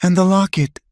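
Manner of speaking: high, tearful catch; grieving mother near tears; sobbed. A narrator's reading. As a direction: cry the words out. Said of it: "she sobbed".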